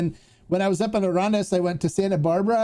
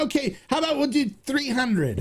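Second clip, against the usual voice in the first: haggling shopkeeper; 8 semitones higher than usual. Retold or imitated. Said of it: "And then".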